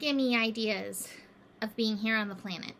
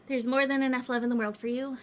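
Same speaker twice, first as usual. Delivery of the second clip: ordinary talk, nothing marked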